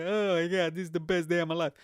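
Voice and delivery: put-on blissful cover-model voice; mock happy couple. Smiling couple on a magazine cover, in a mock blissful voice. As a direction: imitate a delighted couple, quick and gushing. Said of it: "a couple".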